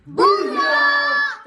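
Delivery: bellowed bird cry. Acted. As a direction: bellow it in his face like a cheeky bird. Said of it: "bellowed right in the mayor's face".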